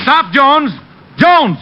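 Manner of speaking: high yell after a running man; detective shouting at the fleeing suspect. Acted. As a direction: call out hard to stop him. Chasing suspect.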